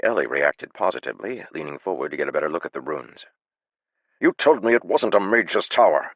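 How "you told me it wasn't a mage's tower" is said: loud, high accusation; accusing. A narrator's reading. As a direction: point the finger and accuse. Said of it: "Haber said, pointing at John".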